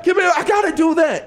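high, loud calling for mommy; excited kid yelling. Retold or imitated. Boy begging his mother for a pen, shouting. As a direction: shout for mom like a desperate kid. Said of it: "That's me running through the house".

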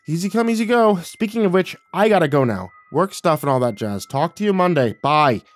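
lightly hurried goodbye to mom; brisk sign-off. Acted. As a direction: get off the phone with false cheer. Hurrying to end the call.

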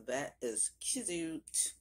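very slow, stretched-out words; playful drawl. Pretend. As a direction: draw every word right out, playfully affected. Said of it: "Why am I talking like this?".

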